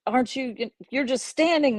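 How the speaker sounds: imitating an annoyed neighbour; mock angry lady. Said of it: "she was kinda got mad".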